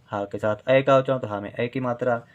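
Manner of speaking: normal talking voice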